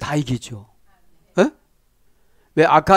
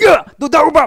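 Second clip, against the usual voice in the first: sudden high shout, much louder; shouting at the mirror; 12 semitones higher, 8 dB louder than usual. Acted. Preacher yelling at the mirror in a challenge, shouted high. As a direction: yell a challenge at your own reflection. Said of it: "막 이렇게도 한번 해보고".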